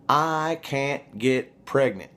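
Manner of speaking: slow, high mock woman's voice; drawn-out imitation of her promise; quoting a woman. Quoting what the woman told him.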